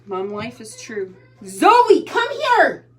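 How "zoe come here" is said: calling out. Real, not acted. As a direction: call her across the house, insistently. Mother calling daughter again.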